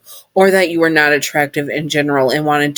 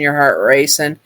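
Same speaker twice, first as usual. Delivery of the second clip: ordinary delivery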